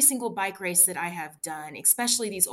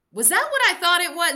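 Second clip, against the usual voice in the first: stunned disbelief; 11 semitones higher, 11 dB louder than usual. Retold or imitated. Shocked self-talk after the assault.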